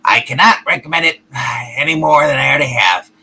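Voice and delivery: low deadpan puppet voice; deep puppet voice. Acted. Puppet voice sustained.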